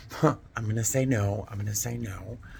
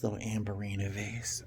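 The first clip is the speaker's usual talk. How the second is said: ordinary talk, nothing marked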